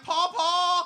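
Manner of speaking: alarmed call. Retold or imitated. Kid calling grandpa in church, loud high cry. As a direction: call out in panic. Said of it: "And I was like".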